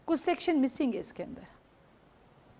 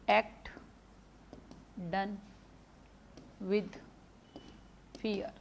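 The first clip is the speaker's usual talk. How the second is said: word by word dictation; 3 semitones lower, 12 dB quieter, much slower than usual. Shown as a demonstration. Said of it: "देखो, act done with fear".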